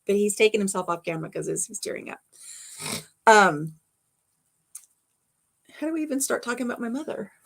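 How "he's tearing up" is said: unvoiced whisper, tearful; whispered aside. Real, not acted. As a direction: breathe it out as a whisper. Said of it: "You can see I'm welling up".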